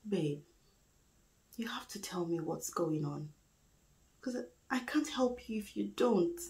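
soft, almost whispered pleading; hushed plea. Acted. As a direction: gently beg a partner to open up, very quietly. Pleading with partner to open up.

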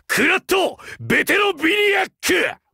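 all-out shouted attack name; finishing move shout. Acted. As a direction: scream the attack name at full power.

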